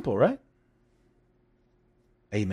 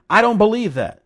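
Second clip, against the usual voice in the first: loud, higher angry snap of disbelief; 6 semitones higher, 10 dB louder than usual. Retold or imitated. Doubter rejecting the promise, angry and loud. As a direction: throw the words back with mock anger. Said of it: "And with anger".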